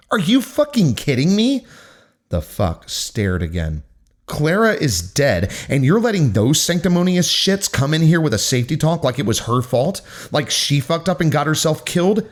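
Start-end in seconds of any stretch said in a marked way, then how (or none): 0.1-1.7 much higher, incredulous angry blast; furious outburst. Acted. Ella erupts at the principal. 4.3-12.2 angry confrontation. Acted. Ella confronts the principal.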